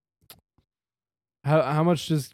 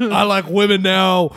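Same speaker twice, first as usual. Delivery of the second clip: goofy put-on voice; 4 semitones higher, 7 dB louder, slower than usual. Joke reply in put-on voice.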